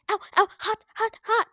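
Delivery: high, quick ouch cries; pained yelps. Acted. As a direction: yelp like feet are burning. Running through maze of fire.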